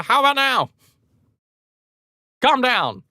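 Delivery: high-pitched distracting call; mock calling out. Ladies calling out to distract hero.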